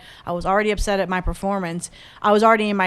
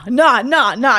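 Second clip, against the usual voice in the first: ordinary talk, nothing marked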